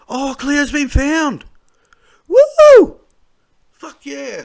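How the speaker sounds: joyful cheer. Real, not acted. Seeing the missing girl is found, he cheers with joy. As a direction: whoop with relief and delight.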